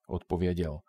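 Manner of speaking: plain reading voice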